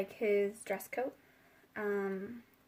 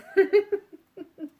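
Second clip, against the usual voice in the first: loud high burst of laughter; 9 semitones higher, 9 dB louder than usual. Real, not acted. Reader laughing at the card's smug reply. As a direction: crack up at your own joke. Laughs at her own line.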